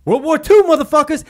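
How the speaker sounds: loud, high, hammed-up shouting; mock patriotic yelling. Retelling boot camp, yelling about the war. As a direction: bellow it like a drill sergeant hyping the troops.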